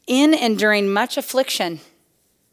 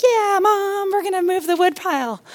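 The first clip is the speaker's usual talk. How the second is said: put-on eager little kids; 8 semitones higher than usual. Kids answering mom about the wood pile, eager high voices. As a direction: imitate small children going along with mom.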